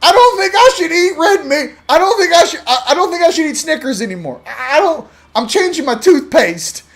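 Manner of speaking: high, loud, dazed through laughter; newly awakened family member. Pretend. Family member waking up spiritually, high bewildered voice with laughter. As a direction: sound like someone suddenly questioning every habit, high and amused. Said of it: "All of a sudden they're like".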